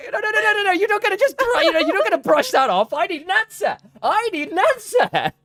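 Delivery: high, loud teasing insistence; excited insisting. Real, not acted. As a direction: demand an answer playfully. Host pressing guest for answer.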